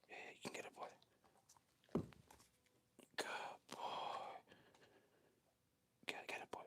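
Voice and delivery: very soft, whispered encouragement; whispered coaxing. Real, not acted. Trainer urges the dog to get it, whispering. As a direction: coax a dog under your breath.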